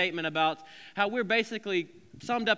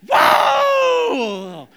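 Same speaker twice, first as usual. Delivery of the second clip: a much higher, loud mock yell of "whoa"; sudden grandma yell; 14 dB louder than usual. Imitating a grandma cheering at a basketball game, loud mock yell. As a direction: burst out like a fan at a game.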